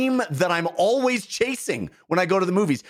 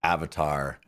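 mock-formal; 10 semitones lower than usual. Mock-formal answer to the host.

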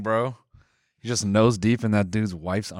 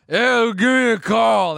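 high, loud and slow, hammed-up; mock neighbor voice; 10 semitones higher, 7 dB louder, slower than usual. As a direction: drag out the creepy invite like a weird neighbor. Mocking the veteran neighbor.

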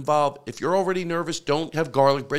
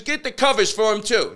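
higher imitated English accent; 7 semitones higher, 3 dB louder than usual. Hotel manager instructing his helpers, imitated English accent. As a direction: give the order in a mock English accent. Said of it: "he had an English accent".

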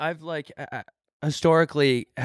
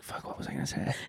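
much softer, under-the-breath retelling; hushed aside; 13 dB quieter, faster than usual. Retold or imitated. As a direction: drop it to near whisper. Retold muttering when caught out.

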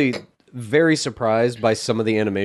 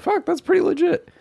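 impressed. Retold or imitated. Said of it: "I was like".

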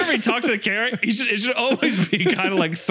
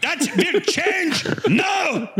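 Hitler building to a rant; 2 semitones lower, slower than usual. Acted. Vlogger recalling wasted content hours, building to a dictator's shout. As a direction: build like a rally speech.

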